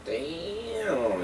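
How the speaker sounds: soft, high, drawn-out "damn"; impressed disbelief. Real, not acted. Friend reacting to the cheating story, soft drawn-out amazement. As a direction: let it stretch out softly in amazement.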